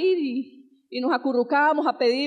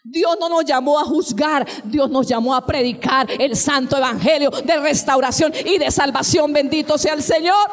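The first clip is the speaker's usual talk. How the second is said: loud, rising preacher's cry; fiery preacher crying aleluya over the congregation; preaching at full force; 6 dB louder than usual. Acted. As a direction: build to a shouting climax, proclaiming freedom. Preaching exhortation.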